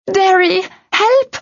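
high, urgent cry for help; desperate call for help. Acted. Fighter calling a friend as the troll attacks, desperate cry.

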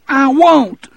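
high, loud petulant refusal; childish defiance. A narrator's reading. Percy refusing Broodle's order, childish high defiance.

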